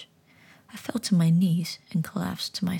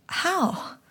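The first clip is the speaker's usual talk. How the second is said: astonished; 10 semitones higher than usual. Acted. Boy astonished.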